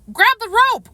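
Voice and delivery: high loud shout. Acted. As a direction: shout it over the chaos. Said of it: "Quincy shouts".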